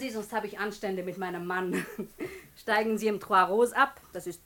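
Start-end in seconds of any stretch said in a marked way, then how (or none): 1.9-2.4 laugh. Acted. Madame laughs at her own joke.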